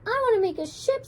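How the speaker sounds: high, stubborn insistence; insisting. A narrator's reading. Simone insists to Meg on a ship, stubborn. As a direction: dig in and refuse to budge. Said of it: "Simone insisted".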